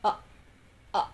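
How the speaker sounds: "uh" cut short by a closed glottis; glottal stop. Shown as a demonstration. Presenter showing what a glottal stop is, "uh" stopped abruptly in the throat. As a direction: snap the throat shut on "uh". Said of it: "it sounds like".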